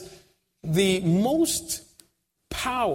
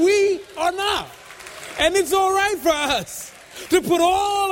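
full-force preaching; 7 semitones higher, 3 dB louder than usual. Acted. Preacher to congregation, declaring all hope in God, forcefully. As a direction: build it up and proclaim.

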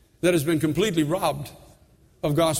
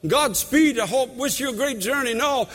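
preaching at full force; 7 semitones higher than usual. Acted. Pastor urging the church to mentor, high and ringing. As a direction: build the sermon to a high, ringing call.

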